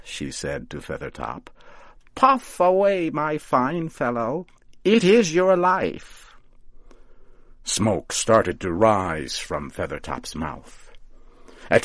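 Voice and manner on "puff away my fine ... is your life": high, sing-song coaxing of a pet; coaxing witch. A narrator's reading. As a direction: urge him on like a doting old witch. Coaxing scarecrow to smoke.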